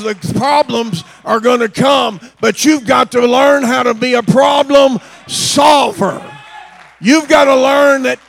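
loud, building sermon shout; fiery preacher climbing to a shout; preaching at full force. Acted. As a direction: drive it home to the congregation.

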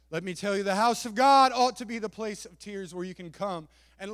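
loud, high preaching; preaching at full force. Acted.

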